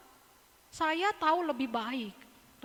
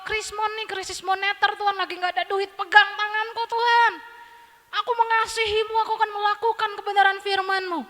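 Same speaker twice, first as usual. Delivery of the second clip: a believer begging God, pitched up and louder; desperate prayer; 6 semitones higher, 6 dB louder than usual. Acted. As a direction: plead to God in hard times. Jemaat berdoa saat krisis. (doa memohon dengan suara tinggi dan keras)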